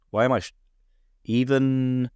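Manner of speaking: usual speaking voice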